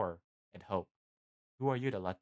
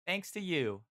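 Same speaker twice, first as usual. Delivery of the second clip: grateful hero; 8 semitones higher than usual. A narrator's reading. Jack's grateful praise, high voice.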